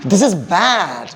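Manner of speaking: "bad" drawn out with a swooping fall; expressive intonation. Shown as a demonstration. Teacher models intonation for repetition, bad stretched. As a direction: lean long on bad. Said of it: "ഇതാണ് ഇൻറ്റോണേഷൻ".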